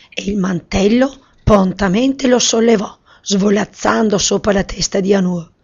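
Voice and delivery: ordinary delivery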